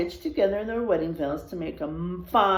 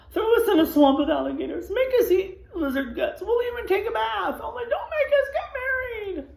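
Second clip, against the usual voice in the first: rising higher and louder in panic; desperate pleading; 14 semitones higher, 4 dB louder than usual. Acted. Gang begging the widow, panicked high pleading. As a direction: beg wildly, climbing in pitch. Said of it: "They burst into tears".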